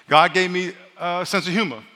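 usual speaking voice

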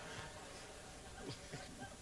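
breathless high laugh. Real, not acted. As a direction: let out a burst of laughter. Laughing at the football story.

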